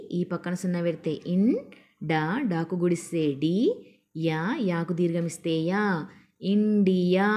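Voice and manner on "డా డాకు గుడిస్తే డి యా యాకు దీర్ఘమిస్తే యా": ordinary talk, nothing marked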